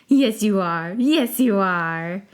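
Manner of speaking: slow cooing pet praise; baby talk. Acted. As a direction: coo to a good little pet. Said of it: "in a baby-like voice".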